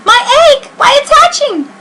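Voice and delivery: excited shout. Acted. Horton watching the egg crack, very high and thrilled. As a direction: squeal it in pure wonder. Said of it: "shouted Horton".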